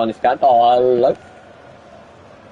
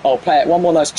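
ordinary talk, nothing marked

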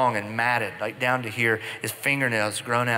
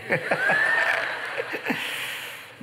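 breathy laugh. Real, not acted. Audience laughter after punchline.

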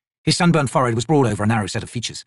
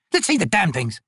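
high, impatient order; snapped demand; 7 semitones higher than usual. A narrator's reading. Corporal hurries the trader along, snapping. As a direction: demand to see the goods. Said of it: "Picker snapped".

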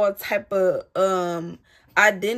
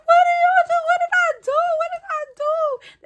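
much higher, louder, frantic questions; panicked pleading; 5 dB louder than usual. Retold or imitated. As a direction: retell the panic of being arrested, voice way up. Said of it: "I'm like".

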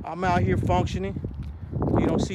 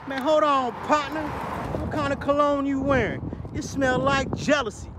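pitched way up, teasing and theatrical; mock sniffing out jealousy; 7 semitones higher than usual. Teasing his jealous day-one brother, high mock outrage. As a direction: call him out like a snooty comedian. Mocking a jealous friend, punchline.

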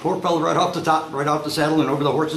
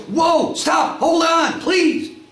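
high, loud, frantic pleading; panicked shouting; 4 dB louder, much slower than usual. Acted. Rider to horse as the cliff comes up, panicked shouts. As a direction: shout desperately for the horse to halt. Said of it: "he's starting to panic".

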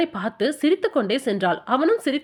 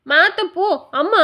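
put-on high whiny little boy, starting to cry; a spoilt grandson about to bawl; whining child; 6 semitones higher than usual. A narrator's reading. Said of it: "அழ ஆரம்பித்தான் குழந்தை".

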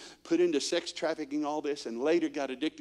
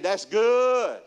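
higher and louder, drawn out That's good; Andy Griffith voice again; 6 semitones higher, 7 dB louder than usual. Shown as a demonstration. Preacher leading the congregation, Andy Griffith style. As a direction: give That's good a big folksy lilt. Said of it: "Say it again".

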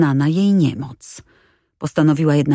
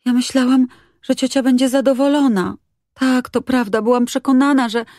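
eager pleading; 8 semitones higher than usual. A narrator's reading. Girl pleads, raised pitch.

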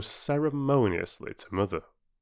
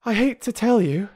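breathless; 6 dB louder than usual. Acted. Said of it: "she said breathlessly".

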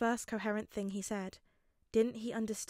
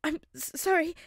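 high, loud tearful stammer; 9 semitones higher, 8 dB louder than usual. A narrator's reading. Boy apologizing to his classmate, near sobbing. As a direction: choke the sorry out through tears. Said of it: "hear the tears in Midoriya's voice".

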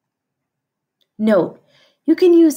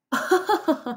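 short laugh; 6 semitones higher than usual. Real, not acted. Laughs at the silly sound.

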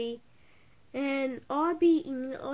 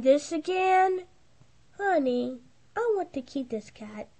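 normal talking voice

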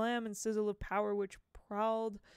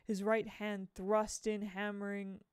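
ordinary delivery, nothing marked

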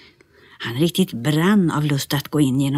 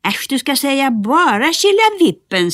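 mild yell from below; 10 semitones higher, 5 dB louder than usual. Acted. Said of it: "skrek Nisse där nerifrån".

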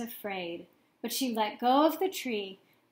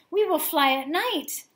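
raised, eager voice; can't wait, louder; excited; 6 semitones higher, 6 dB louder than usual. A narrator's reading. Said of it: "Stella Luna said excitedly".

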